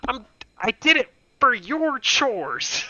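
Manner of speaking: put-on high, slow whiny kid voice; mock wounded plea; whiny protest. Younger brother protesting to sister, hammed-up whine. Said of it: "I'm like".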